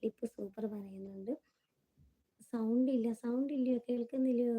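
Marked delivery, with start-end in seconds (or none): 2.5-4.5 raised voice, checking they can hear; louder, insistent questioning; ശബ്ദമുയർത്തി ചോദ്യം. Real, not acted. Answering complaints that sound is inaudible. (ഉച്ചത്തിൽ, കേൾക്കുന്നുണ്ടോ എന്ന് ചോദിക്കുന്ന മട്ടിൽ)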